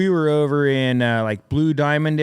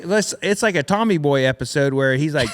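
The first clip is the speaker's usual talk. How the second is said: ordinary talk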